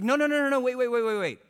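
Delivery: high, loud rapid-fire protest; acted panicky string of repeats; imitated urgent pleading. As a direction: mimic a seller frantically stopping a customer. Seller calls departing customer back.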